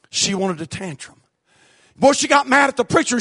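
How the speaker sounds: normal talking voice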